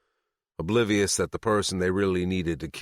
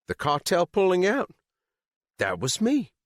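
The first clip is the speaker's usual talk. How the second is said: proud; 4 dB louder than usual. Acted. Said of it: "she said proudly".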